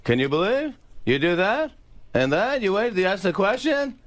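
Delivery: high, questioning comedian impression; Jerry Seinfeld. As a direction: lift each phrase high like a stand-up setup. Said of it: "three different Jerrys".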